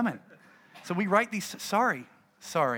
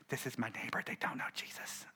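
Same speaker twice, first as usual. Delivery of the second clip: imitating a stage whisper, much softer; loud whisper; 5 semitones lower, 12 dB quieter than usual. Churchgoer introducing her unsaved neighbour to the pastor, stage whisper. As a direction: whisper it for all to hear. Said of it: "she's like".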